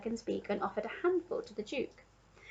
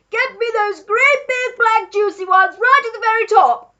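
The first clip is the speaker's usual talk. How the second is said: high, booming demand; old man shouting; 12 semitones higher, 14 dB louder than usual. A narrator's reading. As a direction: holler greedy orders up to the treetop. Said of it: "the old man was shouting".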